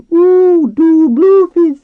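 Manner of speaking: very high, drawn-out squealed shout; shouting dolphin. A narrator's reading. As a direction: shriek it slowly like a calling dolphin.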